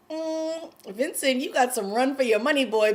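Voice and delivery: high, knowing mock warning; teasing warning. Fan warns Vincent about his rival Gabe, teasing warning.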